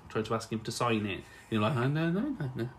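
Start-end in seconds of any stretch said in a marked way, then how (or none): 1.8-2.7 high-pitched dumbstruck babble; starstruck. Acted. Fan trying to ask for an autograph, starstruck. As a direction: squeak helplessly instead of asking. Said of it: "gone a bit numb in the head".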